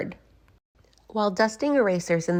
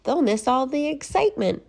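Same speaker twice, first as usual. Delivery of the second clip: put-on kids' voice; 8 semitones higher than usual. Kids' voice in read story.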